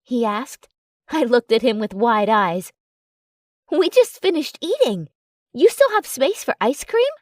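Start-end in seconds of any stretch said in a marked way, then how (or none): 3.7-7.1 amused disbelief, pitched up. A narrator's reading. Narrator teasing boyfriend about more ice cream, amused. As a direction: tease him about his appetite. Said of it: "I asked in amusement".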